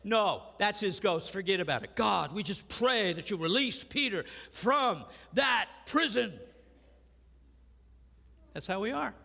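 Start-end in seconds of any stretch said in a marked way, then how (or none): 0.0-2.0 dismissive. Retold or imitated. Disciples dismissing Rhoda. 2.0-6.3 high, loud, mock-desperate pleading to God; loud hammed-up prayer. Disciples praying, ignoring Peter.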